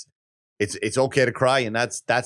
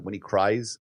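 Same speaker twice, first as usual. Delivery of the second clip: ordinary delivery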